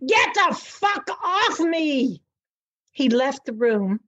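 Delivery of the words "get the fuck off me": high, slow shriek from the memory of the attack; retold shriek. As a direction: shriek it much higher, dragging each word out. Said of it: "I shrieked again".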